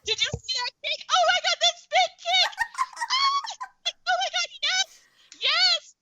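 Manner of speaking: high, loud, laughing cheers at the screen; squealing fan yelling with delight; hyped fan shouting. Retold or imitated. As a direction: cheer the fight like you're on the edge of your seat. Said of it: "like, on the edge of my seat".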